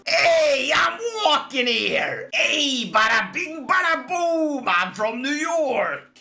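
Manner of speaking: over-the-top New York street holler; New Yorker accent. Acted. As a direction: shout it like a cartoon New Yorker. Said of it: "do my best New Yorker accent".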